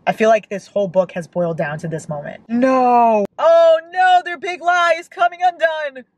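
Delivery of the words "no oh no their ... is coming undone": high, loud wail of dread; dismayed outcry. Real, not acted. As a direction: cry out as if disaster is unfolding. Dreads the lie coming out in book.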